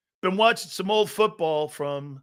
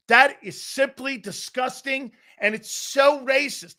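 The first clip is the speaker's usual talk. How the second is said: higher, slow prim indignation; mock outrage; 4 semitones higher than usual. Sports network dudes condemning an ad, slow mock outrage. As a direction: imitate a scandalized pundit, drawing it out. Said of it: "E S P N dudes going".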